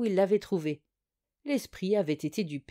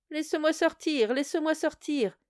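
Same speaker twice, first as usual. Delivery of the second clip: mock cry; 7 semitones higher than usual. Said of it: "cria la chose de nouveau".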